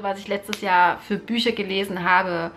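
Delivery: ordinary talk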